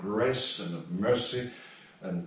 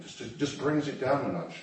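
ordinary talk

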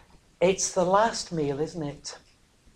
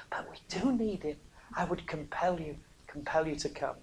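sudden hushed whisper to the congregation; 12 dB quieter than usual. Real, not acted. Preacher whispering to the congregation, hushed whisper. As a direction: drop to a breath, intimate.

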